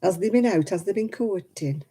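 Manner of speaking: broad Oldham accent, has they and courting; gossipy Oldham accent question. Shown as a demonstration. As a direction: ask it as an Oldham local would. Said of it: "That's an Oldham accent".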